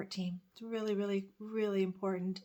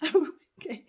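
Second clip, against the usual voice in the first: high, tearful laugh breaking out; real welling-up, loud and high; touched, choked up; 9 semitones higher, 8 dB louder than usual. Real, not acted. Stopping mid-story about her friend, overcome and tearfully laughing. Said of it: "That really touches me".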